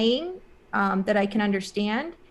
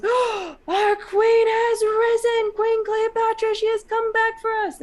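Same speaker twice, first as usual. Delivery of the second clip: awestruck proclamation; 13 semitones higher, 6 dB louder than usual. Retold or imitated. Man at bus doorway hails returned queen, awestruck proclamation. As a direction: proclaim it in a soaring, imitated wail. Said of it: "he hits me with this frequency".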